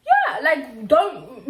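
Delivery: loud laughing words. Real, not acted. Laughing about the woman who mocked her, loud. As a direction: laugh while protesting.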